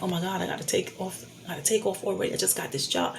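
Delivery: retold worried aside. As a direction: recall fretting to yourself, softly. Recalls panic over missing new job.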